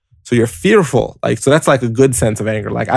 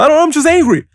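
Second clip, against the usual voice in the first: much higher, louder, petulant mock voice; mock whiny angry reply; 4 dB louder, faster than usual. Angry person asked why they're angry, answering in a much higher mock whine. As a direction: whine it like a sulky kid who just is angry. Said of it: "And you go".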